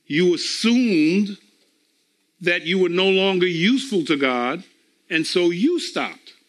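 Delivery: usual speaking voice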